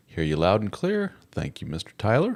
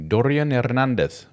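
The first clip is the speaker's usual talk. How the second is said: ordinary talk, nothing marked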